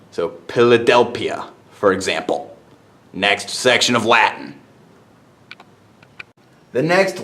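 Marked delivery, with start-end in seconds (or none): 0.5-1.5 "Philadelphia" with P sounds, Pil-a-del-pia. Shown as a demonstration. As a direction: say both PHs in "Philadelphia" as plain P. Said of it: "P H is pronounced as a P".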